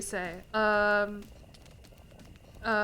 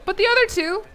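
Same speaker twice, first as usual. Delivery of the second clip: high, loud heading-off of teasing; loud protest; 9 semitones higher, 9 dB louder than usual. Real, not acted. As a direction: cut in loudly over the chat. Heads off chat's teasing, calling out.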